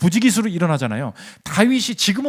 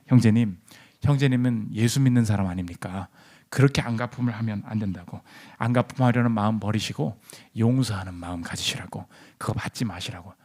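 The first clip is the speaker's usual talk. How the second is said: low, soft pastor's voice, fading at the end; acted gentle admonition; 7 semitones lower, 6 dB quieter than usual. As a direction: play the pastor quietly talking a believer down.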